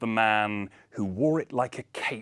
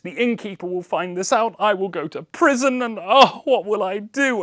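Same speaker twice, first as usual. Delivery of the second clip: put-on crying old man; 6 semitones higher than usual. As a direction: plead like a ruined father close to tears. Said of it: "poor crying old man".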